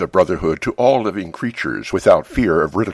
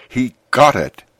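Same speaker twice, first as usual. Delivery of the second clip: slightly raised, emphatic exclamation; a restrained shout, higher in pitch; mild narrated shout; 4 semitones higher, 4 dB louder than usual. A narrator's reading. Invisible beings cheering in his ear, mildly shouted. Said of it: "I heard and felt them shout".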